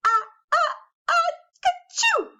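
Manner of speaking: ah-ah-ah building up high, then a loud shrieking ka-choo; a big theatrical sneeze rising to a burst; hammed-up huge sneeze. The bear sneezes for the listening children, a big comic sneeze. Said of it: "gave an enormous sneeze".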